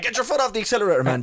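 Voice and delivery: high panicked yell. Retold or imitated. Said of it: "and he's like".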